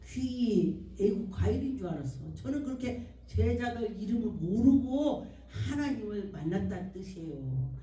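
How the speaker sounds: normal talking voice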